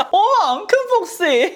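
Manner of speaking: high, slow, giggly sly-fox line; laughing foxy pose. Pretend. Posing for viewers as a sly fox, laughing. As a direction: play the fox through laughter.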